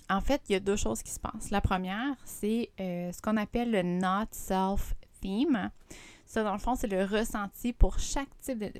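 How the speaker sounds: normal talking voice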